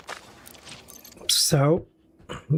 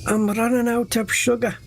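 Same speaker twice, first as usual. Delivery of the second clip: higher, whiny put-on pilot voice; mock distressed pilot; 6 semitones higher than usual. Gamer voices distressed pilot radioing for sugar, higher whine. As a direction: voice the stranded pilot's fussy complaint. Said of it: "And he's in distress".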